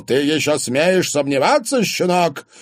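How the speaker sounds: loud, high, indignant question; outraged. A narrator's reading. Father rebukes doubting boyfriend, outraged. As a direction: put the youngster in his place.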